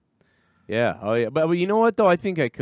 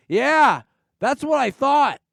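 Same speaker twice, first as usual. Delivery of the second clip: much higher and louder, gloating; smug drunk retort; 12 semitones higher, 7 dB louder, slower than usual. Retold or imitated. Imitating a drunk who just won the argument. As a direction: bark it like a tipsy bully.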